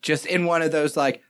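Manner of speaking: ordinary delivery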